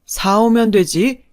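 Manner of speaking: loud, overbearing father-in-law; drunken angry bluster. A narrator's reading. Drunk father-in-law orders wife around.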